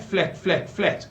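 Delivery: ordinary talk, nothing marked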